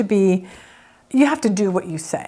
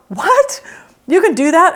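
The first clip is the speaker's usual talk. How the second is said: high disbelieving gasp; surprised; 7 semitones higher, 4 dB louder than usual. Retold or imitated. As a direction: act shocked at the idea. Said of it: "some of you are like".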